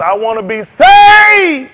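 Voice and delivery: hammed-up wail of a patient begging; mock desperate plea. Psychiatrist cries out to the preacher, slow pleading shout.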